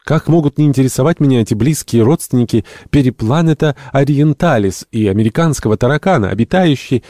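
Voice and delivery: high, loud, indignant enthusiasm; impassioned retort. A narrator's reading. Entomologist defends cockroaches to the captain, in impassioned retort. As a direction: protest fervently, pitched well up.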